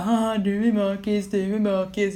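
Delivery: teasing mother. Retold or imitated. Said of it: "kom mamma och bara".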